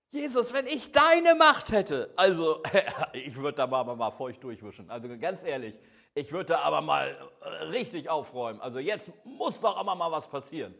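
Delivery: loud, worked-up challenge to Jesus; hammed-up exasperation at full volume; impatient outburst. Believer telling Jesus what they would do with his power, loudly impatient.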